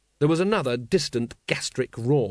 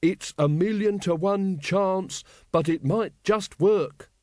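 higher, slow, deliberate delivery; slow and distinct; 6 semitones higher, slower than usual. A narrator's reading. Carrot reminds any forgetful gods, slowly and distinctly. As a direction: space every word out clearly for posterity. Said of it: "speaking slowly and distinctly".

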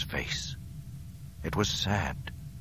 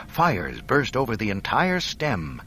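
ordinary delivery

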